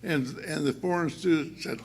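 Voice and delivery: normal talking voice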